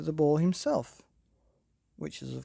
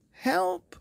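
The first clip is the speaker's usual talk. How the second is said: very high, loud screech; screeching for help; 6 dB louder than usual. Acted. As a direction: scream for help in terror. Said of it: "screeched Herb".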